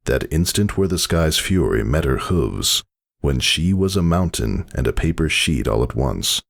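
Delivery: usual speaking voice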